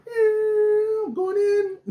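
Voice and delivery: high, loud squeal of a voice; squealing mock Gabby. Mocking a contestant charging in, high and squealing.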